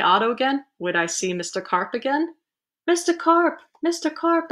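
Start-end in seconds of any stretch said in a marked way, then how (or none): 2.9-4.5 high-pitched calling out; anxious storybook yell; yelled name. A narrator's reading. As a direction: call into the distance for someone missing. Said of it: "I yelled".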